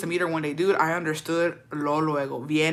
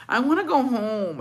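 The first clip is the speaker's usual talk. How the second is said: higher, pleading little moan; plaintive whine; 6 semitones higher than usual. Retold or imitated. Telling parents at grandma's she wants out, plaintive whine. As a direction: whine it, wanting out. Voicing self wanting to leave grandma's.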